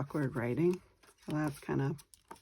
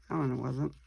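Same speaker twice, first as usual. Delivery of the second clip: usual speaking voice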